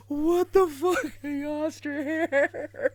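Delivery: half-crying with laughter; laughing disbelief. Real, not acted. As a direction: squeeze it out through laughter. Overcome with laughter.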